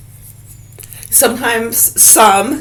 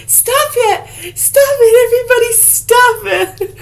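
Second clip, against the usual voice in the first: much higher squealing plea; squeaky Police Academy officer. Retold or imitated. Imitating the Police Academy officer pleading with the crowd. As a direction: shriek it like a mousey cop losing control. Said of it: "Remember Police Academy?".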